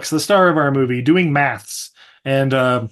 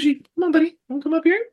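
very high pet-talk voice; coaxing a puppy; faster than usual. Real, not acted. Host inviting the puppy up on the couch, coaxing. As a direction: coax sweetly in a high pitch.

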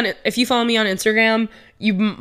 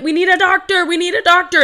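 higher, urgent call over the intercom; urgent announcement; 9 semitones higher, 4 dB louder than usual. Retold or imitated. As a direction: play the crew calling for a doctor. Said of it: "over the announcement".

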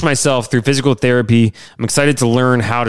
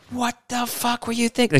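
high, soft mock-scolding wife voice; angry wife, exaggerated; 11 semitones higher, 7 dB quieter than usual. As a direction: ham up the wife swearing at him. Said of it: "She didn't say that".

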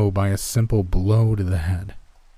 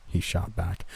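plain reading voice

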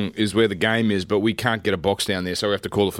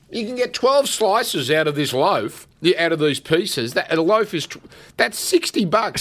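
outraged argument; 7 semitones higher than usual. Retold or imitated. Arguing with Gerds, outraged.

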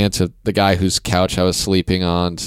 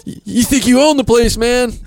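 higher, louder mock indignation at the doorman; mock indignant; 14 semitones higher, 3 dB louder than usual. Comedian mocking the door guy who blocked him, mock indignant. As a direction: puff up like an offended gatekeeper.

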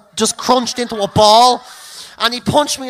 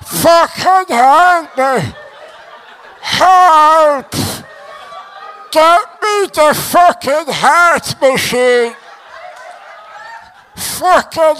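winded panic; 3 semitones higher, much slower than usual. Retold or imitated. Winded boxer begging for help from the canvas, mock-panicked wail. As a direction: wail slowly like a man sure he's dying. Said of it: "lying on the ground thinking I'm having a heart attack".

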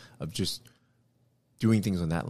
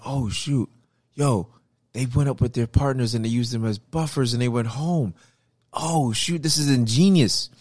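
higher-pitched excited retelling of a stunned reaction; amazed realization; 5 semitones higher than usual. Retold or imitated. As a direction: act out players suddenly catching on, pitch raised. Acting out players' amazed realization.

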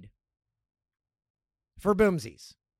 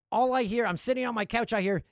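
usual speaking voice

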